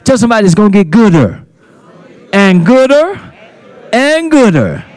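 loud, high GOODER each time; emphasis on GOODER. Real, not acted. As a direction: preach it out, hitting "gooder" hard. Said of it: "Emphasis on gooder".

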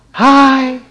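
high little squeaky greeting; Evelyn's squeaky voice. Retold or imitated. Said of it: "little squeaky voice".

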